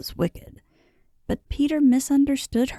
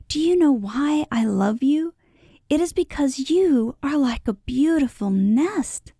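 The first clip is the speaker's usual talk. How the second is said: higher, glowing with adoration; rapturous; 6 semitones higher than usual. A narrator's reading. Peter telling Mamie why he loves her, rapturous. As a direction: pour out the declaration in rapture. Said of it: "he said rapturously".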